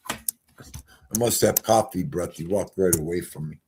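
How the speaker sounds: ordinary talk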